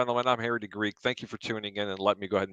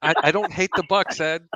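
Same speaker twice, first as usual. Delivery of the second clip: mock insistence; 7 semitones higher than usual. Insists to Ed, raised voice.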